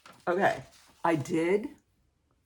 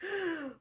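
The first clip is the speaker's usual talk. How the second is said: soft laugh; 12 semitones higher, 10 dB quieter than usual. Real, not acted. Laughing at her own joke.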